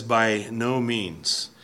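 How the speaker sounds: By no means, slow and weighty, each word set apart; emphatic by no means. Retold or imitated. Preacher quoting Paul's firm denial, slow and emphatic. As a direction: lean hard on By no means, drawn out. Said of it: "it's a very, very emphatic statement".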